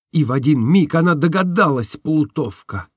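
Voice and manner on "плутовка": plain reading voice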